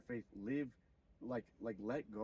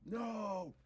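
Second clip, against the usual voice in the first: high yelled no; mock childhood scream under the paddle; retold scream; 9 semitones higher than usual. A boy screaming at his dad's spanking, high yelling no. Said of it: "I'm screaming and shit".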